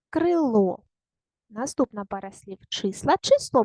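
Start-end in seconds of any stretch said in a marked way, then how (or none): 0.1-0.8 крило with a long final О; stress on ЛО. Shown as a demonstration. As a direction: stretch the second syllable of крило. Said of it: "Поставте наголос у кожному слові". (крило з протяжним наголосом на ЛО)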